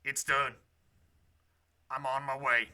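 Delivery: low Southern voice; Southern accent. Acted. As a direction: answer in a low-pitched Southern drawl. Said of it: "Wonderful southern accent".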